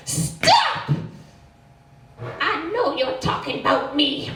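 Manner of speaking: put-on high, loud little-boy outburst; shouting four-year-old. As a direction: imitate a small child yelling an accusation. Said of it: "my four-year-old stands up".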